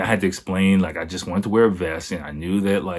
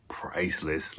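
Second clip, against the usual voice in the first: whispered; 3 semitones lower, 9 dB quieter, slower than usual. Real, not acted. Whispered punchline.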